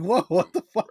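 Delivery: high, loud, laughing "whoa, what the fuck"; laughing mock alarm. Friend acting out his body failing at thirty, laughing alarm. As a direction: play the shock through a laugh.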